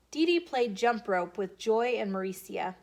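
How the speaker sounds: usual speaking voice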